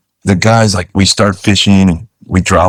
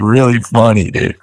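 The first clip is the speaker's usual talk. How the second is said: usual speaking voice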